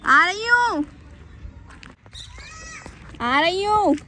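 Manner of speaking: loud high repeated reply; calling back. Real, not acted. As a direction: call out again that you're on your way. Answers son calling her. (बार-बार ज़ोर से पुकार कर जवाब)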